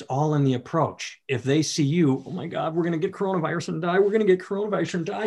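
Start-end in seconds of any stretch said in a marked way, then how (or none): 2.2-4.0 fast, mock-panicked parent babbling; hammed-up panic. 4.0-5.2 higher, fast hammed-up panic; frantic parent, pushed higher; mock panic. Mocking panicked parent, repeated.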